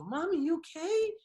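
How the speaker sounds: put-on little boy voice. Dad voicing his son checking on mom, high child voice. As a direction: sound like a worried toddler. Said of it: "and then he goes".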